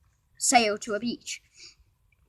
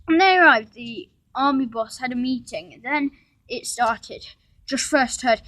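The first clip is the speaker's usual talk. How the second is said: ordinary delivery, nothing marked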